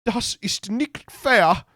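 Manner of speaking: high, slow mock tearful German; hammed-up weepy Merkel; Angela Merkel crying. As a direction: whimper it like a wounded chancellor. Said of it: "the German chancellor said angrily".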